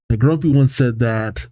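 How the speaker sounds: normal talking voice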